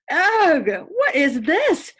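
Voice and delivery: high, loud, slow shout of disgust. A narrator's reading. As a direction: shriek at the sticky mess. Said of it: "she shouted".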